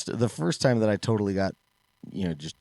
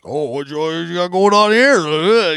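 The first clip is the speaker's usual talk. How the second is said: slow, high, loud prying dad voice; nosy dads; 10 semitones higher, 13 dB louder, slower than usual. Retold or imitated. Rival dads at the derby eyeing the fast car, slow and loud. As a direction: drawl it out like a suspicious rival dad.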